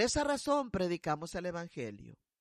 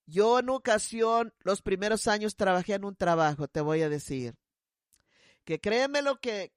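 ordinary delivery

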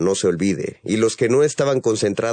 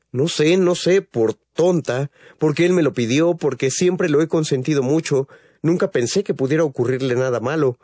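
high, pleading, near tears; distraught mother; 7 semitones higher than usual. Acted. Mother tells reporter why she let Rafael go, distraught and high. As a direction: blurts it out in anguish, much higher. Mother explains letting son go.

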